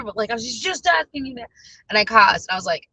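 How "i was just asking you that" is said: mock-annoyed protest, pitched up; retold anger. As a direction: imitate his exasperation lightly. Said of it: "expressing his anger".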